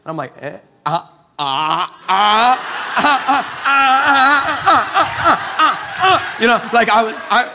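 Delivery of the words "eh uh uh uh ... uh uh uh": repeated "uh" climbing high and loud; excited grunts. Acted. Preacher getting all excited to lay hands, excited. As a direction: build wordless sounds into an excited outburst. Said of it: "I got all excited".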